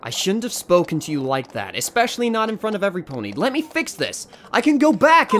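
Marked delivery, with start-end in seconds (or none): 3.3-5.4 desperate offer. Acted. Guard cut off, pleading.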